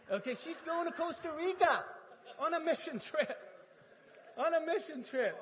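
high, loud mock indignation; emphatic wife. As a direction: imitate a wife loudly insisting. Acted wife insisting over money.

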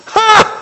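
single high mock "ha!"; high-pitched scornful laugh, put on; imitated scoffing laugh. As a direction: imitate one disbelieving burst of laughter. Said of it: "She laughed".

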